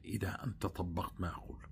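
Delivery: sudden unvoiced whisper. Preacher confiding the key condition to listeners, whispered. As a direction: drop to a hushed secret.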